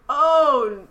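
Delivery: high, loud anguished cry of dismay; heartbroken oh no. Retold or imitated. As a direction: react as if your heart just dropped. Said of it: "And I was like".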